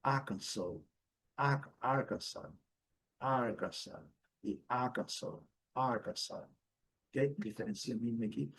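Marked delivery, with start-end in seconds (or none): 0.0-6.5 Arkansas as AR-kan-saw, silent final S; slow Arkansas, stress up front, no S at the end; native English pronunciation. Shown as a demonstration. Teacher contrasts with Brazilian way, slow English demo. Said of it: "porém eles dizem diferente".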